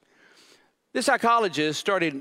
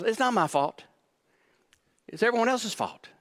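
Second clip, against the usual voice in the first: defensive; 6 semitones higher than usual. Acted. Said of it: "He says".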